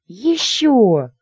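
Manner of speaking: high, shrieky demand; squealing. A narrator's reading. Lady wanting another kiss, squealing. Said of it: "Что ж так визжать-то?".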